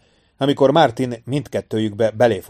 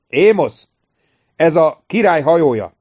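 calling out; 6 semitones higher, 6 dB louder than usual. A narrator's reading. Aruta calling to departing ship.

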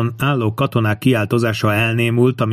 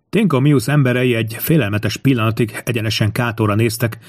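plain reading voice

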